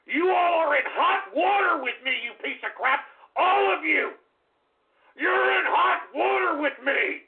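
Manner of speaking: furious shouting. Acted. Ranting threats at chat.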